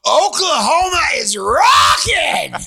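drawn-out, high, loud holler; big slow celebratory shout; triumphant yell. Retold or imitated. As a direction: scream it like a rock frontman to the crowd. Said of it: "And I was like".

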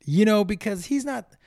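usual speaking voice

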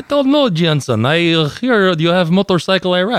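put-on high, goofy broken-English Iranian accent; mock Iranian accent. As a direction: ask to ride the bike in a silly Iranian accent. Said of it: "I'm cousin from Iran".

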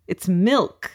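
milk said as melk; saying milk weird. Shown as a demonstration. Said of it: "I can't stop saying the word milk".

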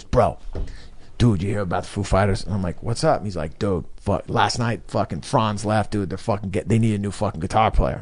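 low, soft buddy voice; retold hushed tip-off between bros; imitated confiding friend. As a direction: pass on the news low and quiet. Retells friend confiding news while smoking.

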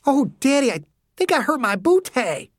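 put-on high girlish whine through a grimace; pained, joking daughter. A narrator's reading. Daughter to her father after falling, pained and joking. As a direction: play up a sore bottom, half amused. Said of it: "she said through a grimacing smile".

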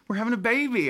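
high, laughing excitement; gleeful announcement. Real, not acted. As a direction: burst out with the happy news. Celebrating baby news with wife.

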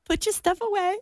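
high, soft mocking teacher voice; a child parroting her teacher, high and quiet. Anna mimics her teacher in class, high soft mocking voice. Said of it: "mocking the teacher".

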